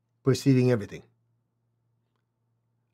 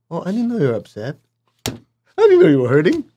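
mock oblivious; 4 semitones higher, faster than usual. Oblivious reply to upset person.

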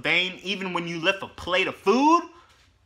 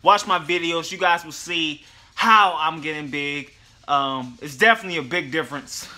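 usual speaking voice

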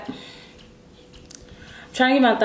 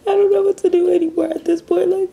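tearful despair; 10 semitones higher than usual. Acted. Tearful cold open before intro.

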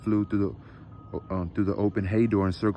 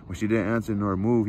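plain reading voice

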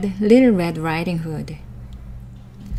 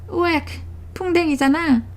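disgusted; 9 semitones higher, 3 dB quieter, much faster than usual. A narrator's reading. Wolf disgusted eating bugs.

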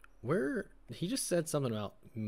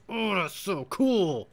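high, loud, slow delighted gush; 8 semitones higher, 7 dB louder, much slower than usual. Real, not acted. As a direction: savour the discovery. Discovering ladder jump mechanic.

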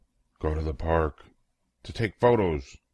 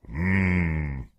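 savoring mmm; 4 semitones lower than usual. Acted. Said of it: "take each bite and say".

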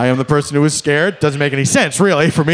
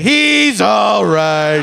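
slow, drawn-out, louder "He's all right"; condescending; 3 semitones higher, 4 dB louder, much slower than usual. Retold or imitated. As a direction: talk down to a scared stranger, stretching every word. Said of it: "I don't think you would say".

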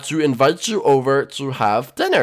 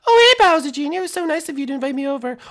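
high falsetto princess, quick; friendly princess voice; 14 semitones higher, 4 dB louder, faster than usual. Acted. Princess thanking her young host, high and quick. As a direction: chatter politely in a high princess voice.

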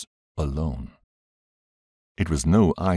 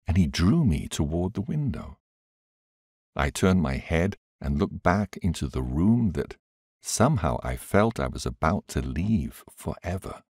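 usual speaking voice

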